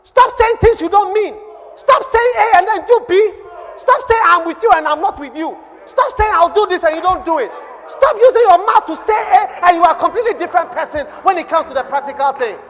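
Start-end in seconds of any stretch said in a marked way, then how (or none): 0.0-9.8 louder, higher exhortation; preacher in full flight; fiery preaching. Acted. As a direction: hammer each "stop" at the congregation.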